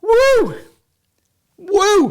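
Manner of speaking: loud high whoops of joy; whooping at the speed test; cheering. Acted. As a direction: celebrate like your team scored. Celebrating the fast internet.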